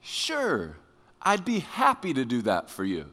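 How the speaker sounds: soft, sugary mock agreement; fake sweet. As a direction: spouse asked to take out the trash, answering in fake sweetness. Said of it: "they respond by saying this".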